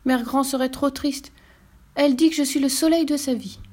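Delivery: put-on lightly high little girl; child pleading. A narrator's reading.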